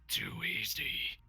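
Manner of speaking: slow drawn-out edgelord taunt; brooding fake-deep mutter. Shown as a demonstration. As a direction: drag it out, smug and ominous. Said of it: "That's a good one".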